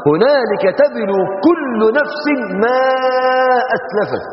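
slow, high chanted verse; Quran recitation. Acted.

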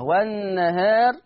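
والنهار slow with alif tilted toward e; slow demo of والنهار with taqlil. Shown as a demonstration. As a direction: read والنهار with taqlil, drawn out. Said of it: "المقلل قولا واحدا،".

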